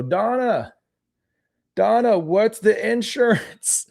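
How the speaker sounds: put-on high, drawn-out old man calling out; older man voice. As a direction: call into the next room like an old man. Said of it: "my older man accent".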